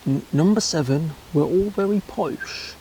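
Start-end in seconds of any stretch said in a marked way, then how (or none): 1.3-2.7 lightly put-on posh English accent, higher in pitch; a hint of plummy posh English on very. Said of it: "or have a Cockney accent".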